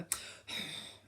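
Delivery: a long unvoiced sigh; breathy demonstration sigh; demo sigh. Shown as a demonstration. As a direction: let out a heavy sigh. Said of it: "So I'll go".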